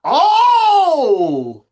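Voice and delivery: long, loud, high "ohh!"; shocked "oh". Real, not acted. Co-host reacting to the traded pick, shocked shout. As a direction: cry out "oh" high and drawn out.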